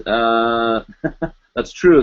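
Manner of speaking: ordinary delivery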